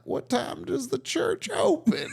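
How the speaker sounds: put-on high, slow old-lady voice; hammed-up elderly caller, drawn out and shrill. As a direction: imitate a little old lady on a prank call. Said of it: "like, an old lady".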